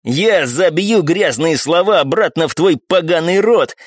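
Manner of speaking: cocky fighter working himself up; fired-up threat. A narrator's reading. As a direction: threaten with swagger, drawing it out. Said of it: "раздухарился Носиков".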